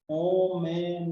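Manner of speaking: "homenage-" drawn out in syllables; broken-off syllable demonstration. Shown as a demonstration. Teacher working out the form, syllabled and unfinished "homenage-". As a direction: stretch "homenage-" and hang on the GE. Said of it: "como é que você fala?".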